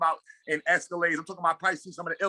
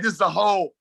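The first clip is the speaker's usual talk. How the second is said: usual speaking voice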